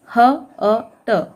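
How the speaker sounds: very slow, drawn apart: ह... अ... ट; sounding out letters. Shown as a demonstration. Teacher sounding out a word for pupils, very slow and separated. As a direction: stretch each sound of हट apart. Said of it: "हट".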